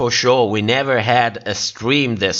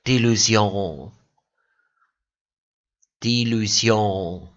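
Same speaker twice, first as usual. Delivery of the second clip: French accent; 4 semitones lower, slower than usual. Shown as a demonstration. Streamer tries the queen name in a French accent. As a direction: say "Di Lucion" the French way, nasal end. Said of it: "Does it sound French?".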